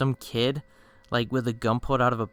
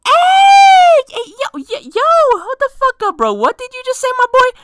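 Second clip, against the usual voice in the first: very high, loud comic alarm; shocked shriek; 14 dB louder than usual. Retold or imitated. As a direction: shriek to halt your friend mid-confession. Shocked reaction to pregnancy request.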